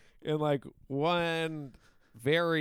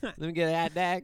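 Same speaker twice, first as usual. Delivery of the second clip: put-on "hot dog" said as "hat dag", New Jersey accent; 3 semitones higher than usual. Mocking Jersey locals ordering, New Jersey accent saying "hot dog" as "hat dag". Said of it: "the way they say hot dog".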